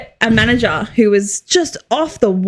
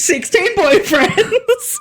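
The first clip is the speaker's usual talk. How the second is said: laughing; 8 semitones higher, much slower than usual. Real, not acted. Laughing joke.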